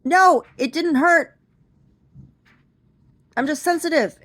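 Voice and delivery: high, loud pleading excuse; mock-flustered excuse; embarrassed insisting. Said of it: "And I was like".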